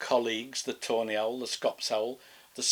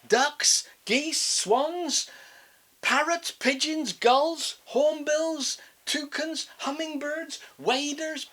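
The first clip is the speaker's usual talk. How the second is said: climbing, rising list; rising excitement; 11 semitones higher, 5 dB louder, slower than usual. Acted. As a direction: pitch climbing higher and higher. Birds rising ever higher.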